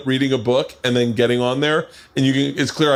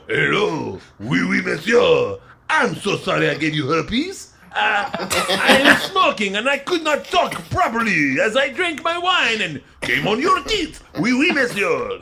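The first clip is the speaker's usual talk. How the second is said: high, slow, hammed-up French accent; 8 semitones higher, slower than usual. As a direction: play a cartoon Frenchman with a thick French accent.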